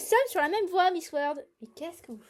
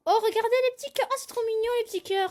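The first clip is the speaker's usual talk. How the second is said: cooing; 7 semitones higher, much faster than usual. Real, not acted. Cooing over cute hearts.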